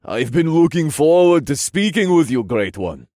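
loud, high-lifted regal voice; friendly king. Acted. King greeting the great one, friendly loud voice. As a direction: greet heartily in a grand royal voice. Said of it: "the king said in a friendly tone".

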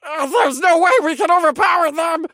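high, loud, frightened little elf; quivering cry. A narrator's reading. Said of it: "cried out in a quivering voice".

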